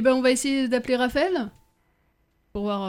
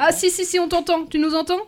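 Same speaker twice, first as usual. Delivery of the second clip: raised voice, calling to a bad line; 5 semitones higher, 5 dB louder than usual. Real, not acted. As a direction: call out to be heard. Calling to caller on phone.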